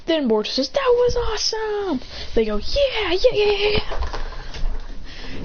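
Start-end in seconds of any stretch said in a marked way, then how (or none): 0.7-2.0 very high, stretched exclamation; acted excitement. Boruto praising the winning move, high excited voice. 2.6-5.4 very high cheering; acted group cheer. Team cheering after their win, high excited yells.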